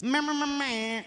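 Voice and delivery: scratchy DJ "meh meh" sounds; DJ noises. Shown as a demonstration. Comic showing friends his game-night DJ noises. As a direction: mimic a record scratch with your mouth. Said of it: "I make D J noises".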